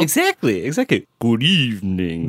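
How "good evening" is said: slow, mild Transylvanian greeting; a light vampire-count drawl; Romanian accent. Pretend. Host copying co-host's greeting, mild Romanian accent. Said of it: "that sounds like Transylvania".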